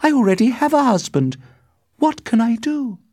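high, loud desperation; crying out. A narrator's reading. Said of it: "she cried".

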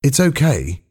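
usual speaking voice

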